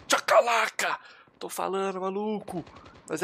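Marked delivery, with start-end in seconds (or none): 0.1-1.0 high, punchy exclamation; playful high-pitched victory shout; gleeful battle cry. Real, not acted. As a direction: crow it high as the shot lands. Celebrating his shots.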